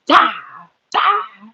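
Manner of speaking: high, soft sing-song "die, die"; a creepy high little taunt; playful villain croon; mock menacing.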